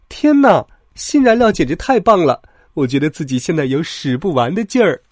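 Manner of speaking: high, loud burst of delight, easing at the end; overjoyed engine full of energy; excited. A narrator's reading. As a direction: exclaim with thrilled amazement. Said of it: "都因为新燃料而兴奋".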